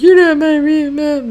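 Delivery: high-pitched mock stepchild protest; mock bratty whine. Voicing a resentful stepchild.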